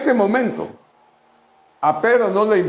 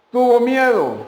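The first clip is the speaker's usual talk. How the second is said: fearful; 7 semitones higher, 3 dB louder than usual. A narrator's reading. Peter sees the storm.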